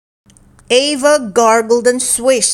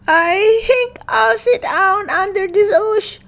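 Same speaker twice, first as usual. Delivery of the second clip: little dinosaur voice; 11 semitones higher than usual. A narrator's reading. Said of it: "said Ava".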